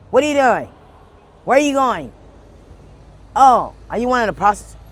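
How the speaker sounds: high, loud interrogating officer; loud, accusing questions; accosting officer. Retold or imitated. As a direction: imitate an officer challenging someone on the street. Voicing officer accosting her.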